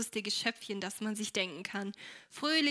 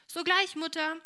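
cheerful reply; 7 semitones higher, 6 dB louder than usual. Acted. Cheerful daughter answers mother.

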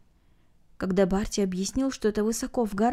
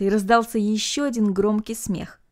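plain reading voice

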